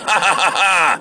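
hammed-up jeering cackle; mocking laugh. Doubters jeering at Noah's boat, mocking laugh. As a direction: fake a big derisive laugh.

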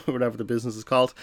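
normal talking voice

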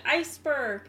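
mild mock yell. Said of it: "They both start yelling".

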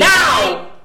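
high, loud one-word bark; furious shout. Acted. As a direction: explode at her. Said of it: "Yelling loud".

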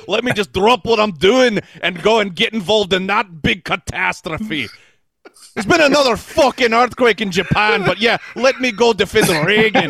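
God sarcastically complaining about his chores; sarcastic put-upon God. Acted. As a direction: whine like an overworked deity. God sarcastically ranting at humans.

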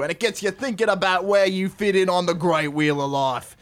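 hammed-up, sweary Aussie rules reading; put-on broad Australian accent, punching the swear words; bad Aussie accent. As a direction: read it out like a crude Australian trucker. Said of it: "do it in Aussie accent".

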